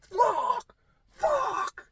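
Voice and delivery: 1 high panicked cry. Retold or imitated. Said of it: "I'm like".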